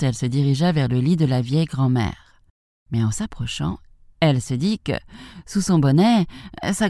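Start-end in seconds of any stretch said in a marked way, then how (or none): none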